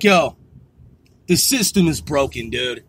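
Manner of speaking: low, grim aside; low deadpan. Retold or imitated. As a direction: sink down low as if defeated. His stunned reaction, quoted.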